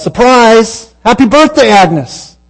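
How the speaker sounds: high, loud, joyful shout; retold surprise party yell; crowd yelling surprise. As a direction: burst out like a room full of friends. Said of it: "Everybody yells".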